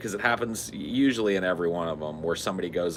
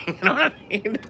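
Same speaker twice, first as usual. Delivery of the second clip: laughing; 13 semitones higher, faster than usual. Real, not acted. Laughing mid impression.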